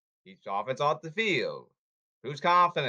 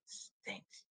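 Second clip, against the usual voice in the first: whispered quote; 4 semitones higher, 14 dB quieter, much slower than usual. Retold or imitated. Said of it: "Like Bob Buck said".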